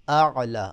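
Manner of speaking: tajweed demo of اعلی; hamza then ain. Shown as a demonstration. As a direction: separate the ا and ع in اعلی. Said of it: "حمزہ ہے پہلے اور پھر عین ہے".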